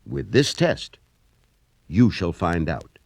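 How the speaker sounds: ordinary talk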